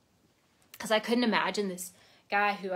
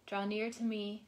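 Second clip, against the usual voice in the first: soft, measured devotional read; 5 dB quieter than usual. Acted. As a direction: read it like a quiet prayer to the listener. Reads a daily devotional aloud.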